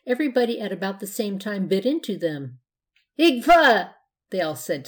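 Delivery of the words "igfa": loud, high yuck splutter; disgusted spitting-out noise. A narrator's reading. Children biting mushy apples, disgusted spitting noise. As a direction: spit out a foul mouthful. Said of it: "spitting out".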